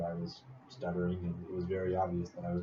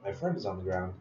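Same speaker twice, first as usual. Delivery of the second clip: ordinary talk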